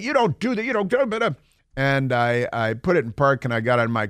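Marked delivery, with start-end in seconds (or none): 0.0-1.3 squeaky imitation of an officer yelling; imitating a police officer scolding him in traffic; mimicking a yelling cop. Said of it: "was yelling at me and shaking her fist".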